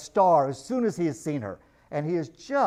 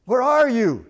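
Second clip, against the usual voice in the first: called out loud and higher across the water; shouted question; 6 semitones higher, 8 dB louder than usual. A narrator's reading. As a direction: shout it out to a stranger in the dark. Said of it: "shouted Caspian".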